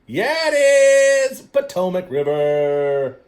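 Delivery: loud, high, drawn-out triumphant shout; excited shout. Real, not acted. Host cheering listeners who guessed the river, excited shout. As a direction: cheer like they just won. Celebrating correct answer, big cheer.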